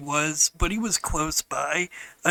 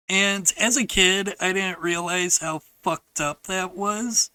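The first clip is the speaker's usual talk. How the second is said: usual speaking voice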